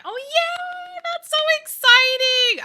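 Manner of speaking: thrilled reaction to a viewer becoming an aunt; real squeal of joy. Real, not acted. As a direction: squeal it like the best news ever. Reading news of a new auntie.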